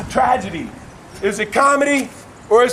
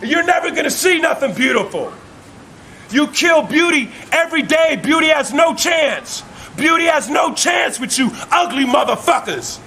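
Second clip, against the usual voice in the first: shouted, pitched-up sermon cadence, hammering each line; fiery street preaching; 5 semitones higher than usual. Acted. Preacher railing at hateful people, shouting a sermon. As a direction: preach it at full force, hammering each repeated phrase. Preaching rant against hatred.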